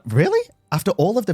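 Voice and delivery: sharp high disbelieving really; incredulous. Real, not acted. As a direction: caught completely off guard. Caught off guard, disbelieving.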